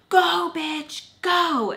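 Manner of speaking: high, loud heckling chant; mocking shout. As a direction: yell it like a pack of hyped boys. Boys yelling at her.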